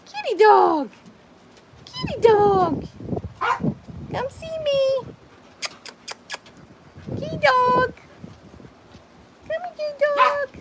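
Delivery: sing-song high call to the dog; high cooing pet voice calling; high pet-talk call. Real, not acted.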